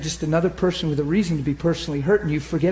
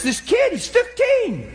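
sudden high-pitched exclamation; high, incredulous preacher's cry; impassioned outburst; slower than usual. Real, not acted. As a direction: burst out in disbelief, voice leaping up. Preacher bursts out in disbelief.

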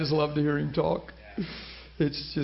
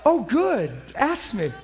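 hammed-up delight, pitched high; eager delight; 6 semitones higher, slower than usual. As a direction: play up being thrilled to be asked. Acting out eagerness to hear her accent.

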